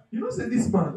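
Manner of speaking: normal talking voice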